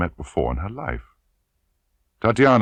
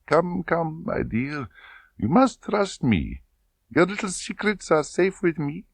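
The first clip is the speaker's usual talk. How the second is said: oily and smooth; 12 semitones higher than usual. Acted. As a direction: wheedle her like a false friend. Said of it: "The voice became oily and smooth".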